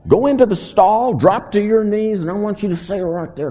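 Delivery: high, loud instructions; insistent coach laying down steps; sponsor's orders. Retold or imitated. Retold sponsor giving orders.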